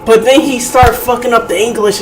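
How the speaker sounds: ordinary talk, nothing marked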